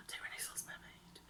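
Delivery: gasp, then whisper it; hushed sudden idea in a whisper; excited whisper. Real, not acted. Vlogger has an idea of what to take, gasping whisper.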